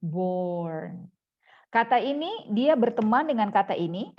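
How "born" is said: drawn-out "Born", falling; model pronunciation. Shown as a demonstration. English teacher modelling the word for students, model pronunciation. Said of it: "ini dibaca born".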